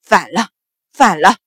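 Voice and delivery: outraged cry, low and forceful; loud, indignant exclamation from the mistress; loud shout. A narrator's reading. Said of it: "秦氏大喊".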